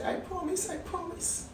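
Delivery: promising the manager meekly, near tears; tearful whispered promise. Retold or imitated. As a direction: barely breathe the promise through tears. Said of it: "And I said".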